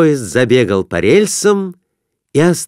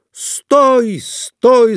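high, loud cry to stop; yelling; 13 semitones higher, 3 dB louder than usual. A narrator's reading. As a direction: shout at the top of his lungs. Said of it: "закричал Конурин во всё горло".

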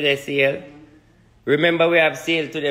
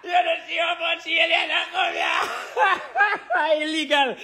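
laughing mock outcry; 13 semitones higher than usual. Laughing mock outcry about aliens.

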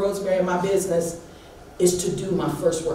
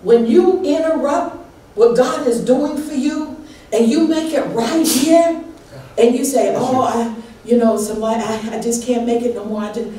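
higher, louder, fervent sermon voice; full-force preaching; 8 semitones higher, 3 dB louder than usual. Acted. Pastor preaching to her church at full force. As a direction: preach it to the rafters, building.

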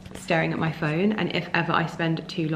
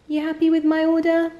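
pet talk; 10 semitones higher, 4 dB louder than usual. Real, not acted. Asking the cat.

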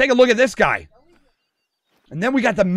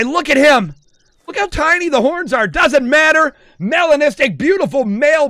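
raised, gushing showcase; excited admiration; 5 semitones higher, 4 dB louder than usual. Real, not acted. Streamer shows off rare animal, excited. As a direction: gush over the prize.

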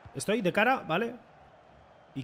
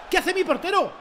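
incredulous; 10 semitones higher than usual. Real, not acted. Goalkeeper blunder.

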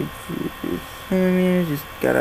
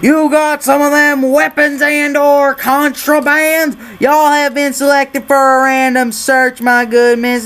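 put-on Texas accent; 8 semitones higher, 4 dB louder than usual. Border officer announces a random search, in a hammed-up Texas accent. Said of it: "Gonna stop doing this annoying Texas accent".

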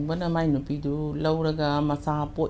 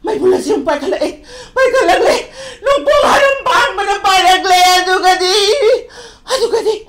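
possessed oracle; 13 semitones higher, 13 dB louder than usual. Shown as a demonstration. Artist demonstrates an oracle from a radio play, frenzied wailing. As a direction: cry out frenzied omens, gasping and cackling between lines. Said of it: "ꯂꯥꯏꯕꯥꯎꯒꯨꯝꯕ".

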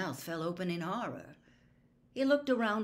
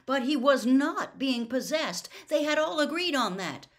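loud, high, agitated insistence; alarmed protest; 8 semitones higher, 8 dB louder than usual. A narrator's reading. Boy insisting he is not possessed, alarmed. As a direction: protest in rising panic.

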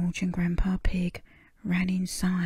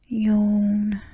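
yawn; 3 semitones higher, 6 dB louder than usual. Acted. Waking up yawning.

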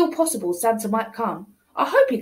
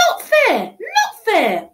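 whiny protest; 7 semitones higher, 3 dB louder, slower than usual. Acted. Child complains.

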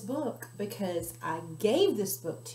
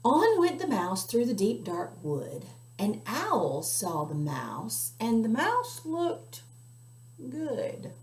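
put-on slow, sing-song read-aloud for children; story narration; 3 semitones higher, slower than usual. A narrator's reading. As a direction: storytime voice for little listeners. Reading a picture book aloud.

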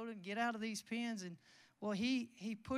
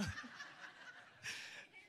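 silent breathy laugh; 3 semitones higher, 10 dB quieter than usual. Real, not acted. Laughing at the offer.

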